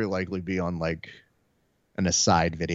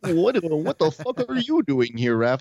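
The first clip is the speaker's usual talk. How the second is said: lightly voiced friend, laughing back; imitating friend; 8 semitones higher, slower than usual. Friend Jevons answering Raph, a light mock impression. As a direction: toss the question back, amused. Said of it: "And he goes".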